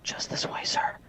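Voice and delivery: whispered assistant's line. Acted. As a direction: say it in a polite whisper. Assistant's line whispered in story reading.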